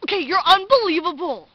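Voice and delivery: very loud, hammed-up outrage; mock indignation. Imitating Lucas offended at her, mock indignant. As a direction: shout it as if deeply offended.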